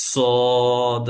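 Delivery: সদ thick and long held; heavy Sad. Shown as a demonstration. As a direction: draw out the full vowel in সদ. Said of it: "তাহলে উচ্চারণ আমি আবার বলছি".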